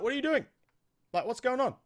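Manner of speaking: high-pitched retold objection; puzzled challenge. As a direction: jump up high, mimicking someone demanding an explanation. Acts out Anatoly confronting the team.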